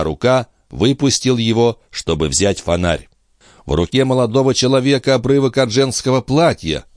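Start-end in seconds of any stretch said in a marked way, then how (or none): 3.6-6.9 higher, agitated cry of discovery; distressed exclamation. A narrator's reading. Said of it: "воскликнул он". (взволнованное восклицание)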